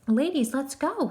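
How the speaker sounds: put-on a touch of a bossy caseworker barking at the girls; lightly voiced stern command; stern order. A narrator's reading. Caseworker ordering the orphans off the train, mild stern order. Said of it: "Miss Smirt ordered".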